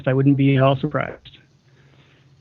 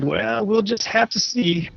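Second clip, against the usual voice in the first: lightly put-on voice, higher and drawn out; slow, higher-pitched quote, lightly acted; mild impression voice; 7 semitones higher, 3 dB quieter, slower than usual. Host acting out a reply on request, lightly put-on slow voice. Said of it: "Yeah, do impressions".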